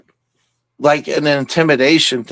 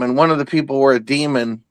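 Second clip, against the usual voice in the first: ordinary talk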